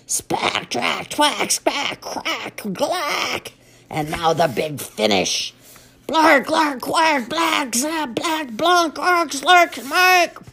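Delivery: high, loud trumpet sounds; elephant noise. Acted. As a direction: build to a big trumpet finish. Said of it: "I'm trying to speak elephant".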